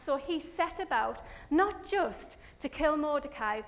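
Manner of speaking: ordinary talk, nothing marked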